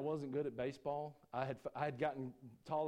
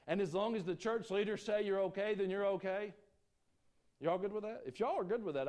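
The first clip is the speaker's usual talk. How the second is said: higher, louder pulpit preaching; preaching at full force; 7 semitones higher, 5 dB louder than usual. Acted. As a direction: preach it hard to the congregation. Mocking church leaders, full preaching.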